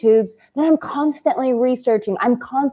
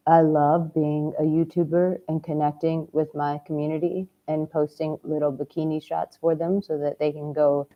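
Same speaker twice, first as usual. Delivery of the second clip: low, soft, lifeless delivery with no passion; unenthused; 6 semitones lower, 6 dB quieter than usual. Shown as a demonstration. Coach showing men the wrong way, unenthused and soft. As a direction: say it like you don't really mean it. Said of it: "But if I said it that way".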